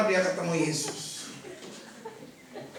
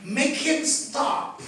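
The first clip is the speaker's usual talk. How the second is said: drawn-out words; 6 semitones higher, much slower than usual. Acted. Dramatising the interruption.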